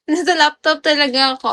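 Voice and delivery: normal talking voice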